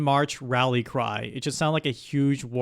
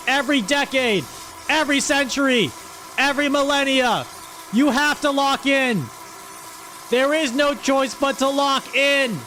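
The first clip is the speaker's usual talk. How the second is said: much higher, louder rally yell at chat; fired-up shouting; 11 semitones higher, 3 dB louder than usual. Real, not acted. Streamer yelling at chat to always lock in, fired-up shout. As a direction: bellow it like a drill sergeant pumping up troops.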